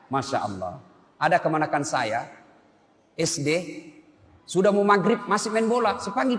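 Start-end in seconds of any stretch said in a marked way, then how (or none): none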